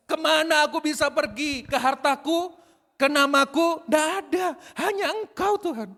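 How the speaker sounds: high, loud, impassioned plea to God; preaching at full force. Acted. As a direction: cry it out to the Lord, pitch held high.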